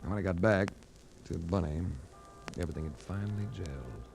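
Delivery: low, even first-person narration; detective narration. A narrator's reading. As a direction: recount the case like a hard-boiled detective. Narrator recounts the case.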